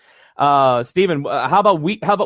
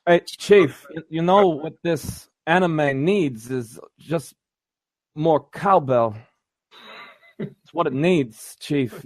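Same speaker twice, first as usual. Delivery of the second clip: impression voice; slower than usual. Cowbell bit, Walken-style voice.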